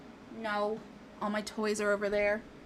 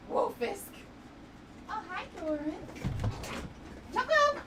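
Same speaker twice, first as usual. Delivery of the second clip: cooing pet talk; 11 semitones higher, 8 dB quieter than usual. Real, not acted. Greeting pets in cooing voice.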